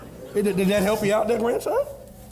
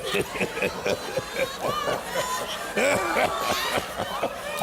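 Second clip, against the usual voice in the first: high wheezing laugh; 4 dB quieter than usual. Acted. Grandpa recalls grandmother.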